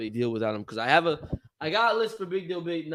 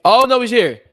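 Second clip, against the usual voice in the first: a higher, louder burst of sudden surprise; surprised; 7 semitones higher, 11 dB louder than usual. Real, not acted. Host spotting David back in the call, surprised. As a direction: react as he pops back in. Cohost reappears unexpectedly.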